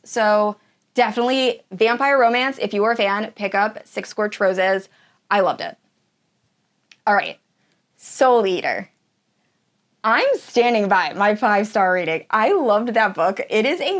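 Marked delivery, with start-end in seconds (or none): none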